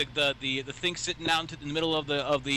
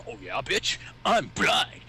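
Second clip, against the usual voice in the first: mock trump card; much slower than usual. Friend mocking him playing the blind card, a smug taunting impression. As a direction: sneer it like playing a winning card. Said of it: "pulling out your trump card".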